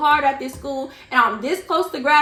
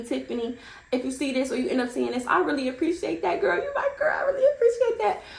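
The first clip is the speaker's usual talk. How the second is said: tearful thanks; 7 dB quieter than usual. Real, not acted. Thanking classmate on camera, tearful.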